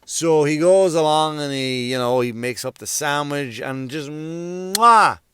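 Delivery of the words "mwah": loud, high relished "mwah"; big showy kiss sound; chef's kiss. Acted. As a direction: smack out an admiring chef's kiss. Chef's kiss over the sandwich.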